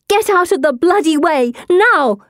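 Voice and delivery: high, forceful shout; yelling. A narrator's reading. Girl leaning from carriage at couple in the way, yelling. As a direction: yell it urgently, punching the last word. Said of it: "yelling at the couple".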